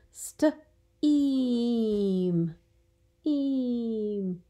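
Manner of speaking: Steam then the vowel Eam pulled out; vowel isolation demo; isolating the vowel. Shown as a demonstration. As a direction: split off the eam sound. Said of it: "If you were holding up your".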